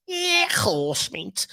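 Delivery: high, exaggerated bad Scouse; hammed-up Scouse with a throaty rasp; awful Scouse accent. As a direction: put on a drawn-out, squeaky Scouse accent. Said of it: "that's just my awful Scouse accent".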